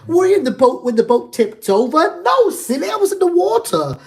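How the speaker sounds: loud, high hammed-up British accent; Harry Potter. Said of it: "I just channeled the Harry Potter".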